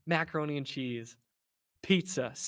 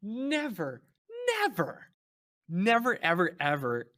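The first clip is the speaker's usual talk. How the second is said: each NEVER drawn out, higher, set apart by pauses; emphatic repetition; 6 semitones higher, slower than usual. Real, not acted. Telling co-host they'd never eat Snickers when hungry, emphatic. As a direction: hammer every "never" and "ever" separately.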